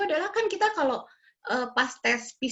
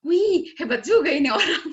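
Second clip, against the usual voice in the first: louder, higher burst of impressed surprise; amazed exclamation; 4 semitones higher, 6 dB louder than usual. Retold or imitated. Wife marvels at husband drinking nine scoops, amazed.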